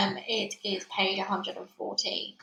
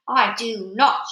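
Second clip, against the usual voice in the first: loud curt witch denial; witch shouting; 7 dB louder, slower than usual. Acted. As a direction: cut her off with a loud no. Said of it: "shouted the Grand High Witch".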